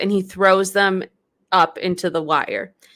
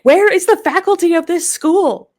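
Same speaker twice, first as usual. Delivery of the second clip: louder, higher indignant demand; all caps; 9 semitones higher, 5 dB louder than usual. Retold or imitated. Host demanding where the teachers are, indignant and loud. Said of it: "in, like, all caps".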